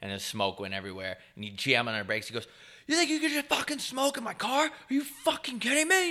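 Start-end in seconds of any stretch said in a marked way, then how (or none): 2.9-6.1 much higher, strained yell of a driver who caught a passenger vaping; imitating an angry driver freaking out; furious driver. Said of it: "He started freaking out on me".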